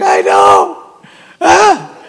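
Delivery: loud, hammed-up commiserating wail; exaggerated sobby sympathy; mock weeping along. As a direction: pretend to weep in sympathy with someone. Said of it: "people to weep with us when we're weeping".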